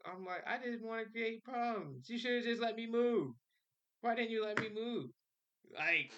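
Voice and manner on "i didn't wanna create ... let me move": frustrated protest. Retold or imitated. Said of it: "you could probably hear the frustration".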